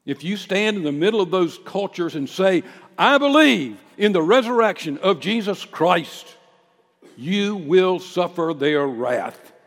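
loud, high preacher's proclamation; full-force preaching. Real, not acted.

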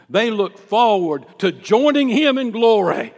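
loud, high preacher's proclamation; full-force preaching. Real, not acted.

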